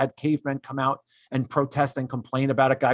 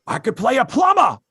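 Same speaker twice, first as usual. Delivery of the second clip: mock shout; 8 semitones higher, 6 dB louder than usual. Mocking actor's complaint.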